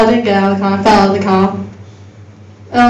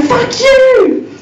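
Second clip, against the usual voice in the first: high-pitched imitation of a child's scream; acted high kid yell; screaming toddler; 13 semitones higher than usual. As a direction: mock-scream it like a toddler at mum. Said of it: "screaming at his mum going".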